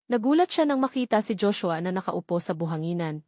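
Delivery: plain reading voice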